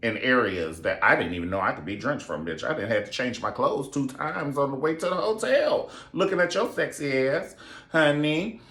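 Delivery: playing the lusty bride, camp and bold; mock seductive high voice; sassy retold Duchess. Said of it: "And she was like".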